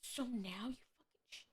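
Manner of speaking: under your breath, can't believe it; frustrated whisper. Real, not acted. Streamer whispering at the game in disbelief. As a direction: whisper it low and fast in disbelief.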